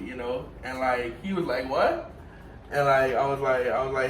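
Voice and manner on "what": higher, rising "what?"; baffled, asking for a repeat; puzzled retort. Retold or imitated. Coworker not understanding the greeting, puzzled.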